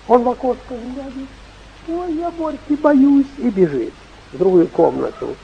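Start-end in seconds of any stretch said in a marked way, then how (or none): 1.9-3.3 put-on high frightened little boy; scared child voice. Grandson afraid of the goat.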